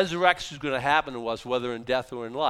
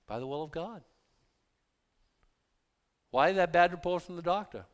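normal talking voice

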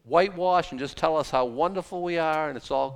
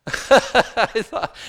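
laughing; 8 semitones higher, 10 dB louder than usual. Real, not acted.

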